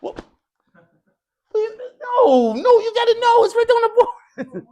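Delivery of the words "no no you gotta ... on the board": high, loud hammed-up wail; mock-tragic cry, much higher and louder; mock despair. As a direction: play wounded disbelief, half crying. Teacher mock cries at student's answer.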